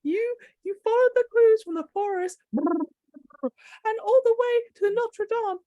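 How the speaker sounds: ordinary talk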